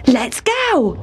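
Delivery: light call, held back in volume; a mild mouse call to set off; mock yell. A narrator's reading. Town Mouse eager to catch the car, mock yelling. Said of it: "he yelled".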